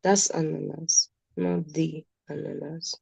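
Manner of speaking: ananas plain first, then not the ananas with the stress on not; stress the contrast. Shown as a demonstration. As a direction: set ananas against the ananas, leaning on not. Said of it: "That's the plural".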